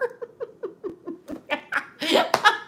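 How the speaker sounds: high helpless laugh at her own mistake; burst of laughter. Real, not acted. Laughing at herself over the hair she hacked off. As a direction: crack up laughing at the botched haircut.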